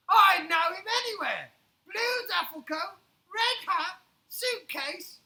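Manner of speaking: high, loud, certain Beefeater; loud recognition. Acted. Beefeater telling the gentleman, loud and sure. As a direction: announce each item of the description. Beefeater describing the bear loudly.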